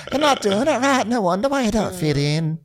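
high, catty mock jab; snippy critic. Friend mocking a loner, high catty put-on voice. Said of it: "He's like".

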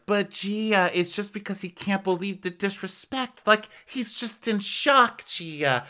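high, sugary pleading; mock Melissa. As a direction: coax Gia, pitched well up. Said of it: "Melissa's like".

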